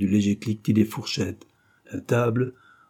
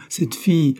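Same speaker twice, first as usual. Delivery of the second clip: prudish indignation; 6 semitones higher than usual. A narrator's reading. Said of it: "s'indigna vivement".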